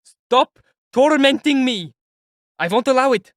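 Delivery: slow, very high and loud yell; raging desperate shout; furious scream. Acted. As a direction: scream at his tormentor. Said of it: "Raskolnikov shouted in fury".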